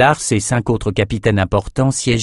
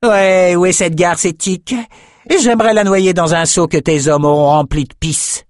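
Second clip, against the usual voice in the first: loud, high snarling woman; growled; 9 semitones higher, 3 dB louder than usual. A narrator's reading. Jealous warrior woman threatening her rival, growling. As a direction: spit venom at her rival. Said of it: "elle grogna".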